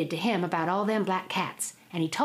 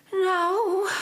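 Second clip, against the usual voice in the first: whiny wife; 11 semitones higher, 4 dB louder than usual. Acted. Sick wife refuses.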